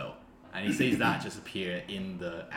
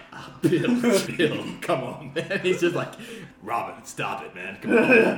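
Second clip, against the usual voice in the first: exasperated guard; 8 semitones higher, 8 dB louder, slower than usual. Acted. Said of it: "the other's just like".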